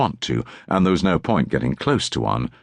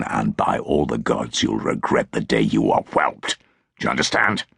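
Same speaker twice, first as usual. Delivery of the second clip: hissed threat; 4 semitones lower than usual. A narrator's reading. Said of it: "Tallis hissed in his ear".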